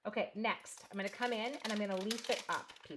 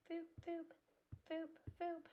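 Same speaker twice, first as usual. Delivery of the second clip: high, soft little boops; playful boop noises; 10 semitones higher, 8 dB quieter than usual. Real, not acted. Crafter sounding out dabs with the pad, playful boops. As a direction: make tiny high-pitched booping sounds. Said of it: "make noises".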